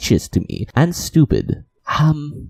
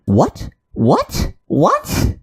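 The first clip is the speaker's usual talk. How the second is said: each what climbing higher in pitch, more incredulous; building disbelief; 5 semitones higher, much slower than usual. Real, not acted. Reacting to a commenter's insult, rising incredulous whats. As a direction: let the disbelief rise with every repeat until it almost squeaks.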